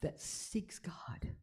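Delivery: slow, secret whisper; hushed slow whisper of the question. Shown as a demonstration. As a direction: lean in and breathe it slowly. Intimate spiritual question, whispered.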